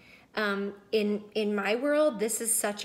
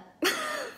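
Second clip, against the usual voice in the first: light laugh. Real, not acted. Laughs at own makeup plan.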